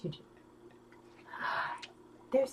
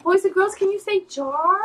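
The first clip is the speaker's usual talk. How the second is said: put-on kids show host; 4 semitones higher, 6 dB louder, much slower than usual. Kids show drill to viewers.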